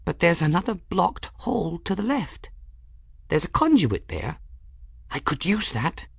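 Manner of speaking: very high, small character voice; light, high-pitched little voice; confiding in crystal. A narrator's reading. Folly tells her crystal about an exit, in a high small voice. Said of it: "she whispered to her crystal".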